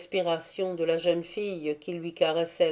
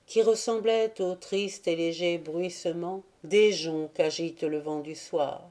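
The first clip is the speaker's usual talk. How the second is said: usual speaking voice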